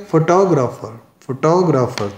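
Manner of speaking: Photographer as fo-TOG-ra-fer; light start on photographer, punch the TO; photographer with the weight on its second syllable; stress on second syllable. Shown as a demonstration. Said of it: "किस पर stress किया".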